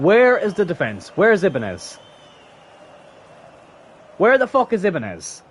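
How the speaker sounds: high, loud, demanding shouts; real fury at the defence; furious rant. Real, not acted. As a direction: yell at the missing defender. Ranting after a conceded goal.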